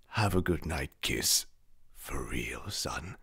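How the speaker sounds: soft, low tender goodnight; quiet fatherly tenderness. Acted. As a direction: lull the son gently.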